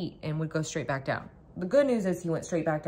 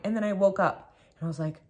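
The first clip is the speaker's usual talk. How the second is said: normal talking voice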